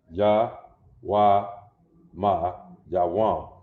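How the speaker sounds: Ya, Wa, Ma spelled out, then Yawam said slowly; teacher sounding out Yawam; syllable by syllable. Shown as a demonstration. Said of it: "Yawam with an M".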